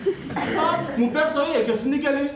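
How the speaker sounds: over-eager impression of small talk. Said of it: "on dit".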